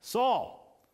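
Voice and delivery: calling out loud and high, rising on the second Saul; voice from heaven calling. A narrator's reading. The heavenly voice calls down to Saul on the ground, loud and high. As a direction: call him by name from above, insistent. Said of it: "and a voice says".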